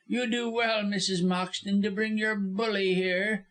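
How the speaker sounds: high-pitched, spiteful lawyer voice; cowardly spite, pitched high; venomous taunt. A narrator's reading. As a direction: needle her from a cowed position. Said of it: "could not curb his venomous tongue".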